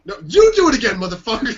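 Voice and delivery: high, loud comic blow-up; mock angry outburst. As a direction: imitate an actor exploding at the director. Fed-up actor snaps back at director.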